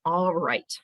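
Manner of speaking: ordinary talk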